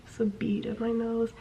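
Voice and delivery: hushed, embarrassed repeat; whisper. Retold or imitated. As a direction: keep it barely audible, just for the teacher. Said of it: "trying to whisper it".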